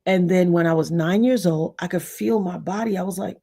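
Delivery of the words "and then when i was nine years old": ordinary talk, nothing marked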